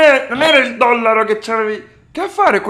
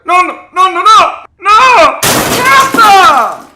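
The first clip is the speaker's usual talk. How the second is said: high loud frantic no's; panicked screaming; 7 semitones higher, 5 dB louder, slower than usual. Real, not acted. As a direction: scream to make him stop. Grandson screams at bat threat.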